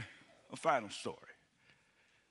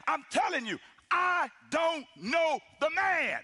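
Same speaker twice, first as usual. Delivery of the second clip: slow, loud, higher shout of denial; cursing denial; 6 semitones higher, 4 dB louder, slower than usual. Acted. Peter denying Jesus to the crowd, a slow loud cursing shout. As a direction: bellow it as if swearing at the crowd. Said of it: "Peter started calling down curses".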